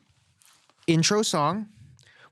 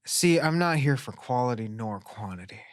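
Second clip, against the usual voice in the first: mock-intense movie star delivery, dropping soft; Nicolas Cage; 5 semitones lower, 3 dB quieter than usual. Telling the co-host what he is here for, Nicolas Cage impression. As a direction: trail down low into hammed-up intensity. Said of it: "you sound like Nicolas Cage".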